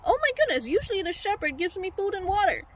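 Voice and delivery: high, mock-alarmed sheep voice; worried sheep realizing. Sheep misses the one who feeds it, high mock worry. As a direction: start high in comic dismay, ease down a little.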